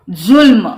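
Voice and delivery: जुल्म said with a clear z at the start; z sound. Shown as a demonstration. Teacher demonstrates the Persian sound, जुल्म with a z. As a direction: buzz the z in जुल्म. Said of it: "ये जो ध्वनि है यह अरबी फारसी से आई".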